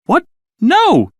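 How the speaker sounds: high, loud shocked outburst; sudden shocked no; shocked cry. A narrator's reading. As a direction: cry out in disbelief. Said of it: "she cried".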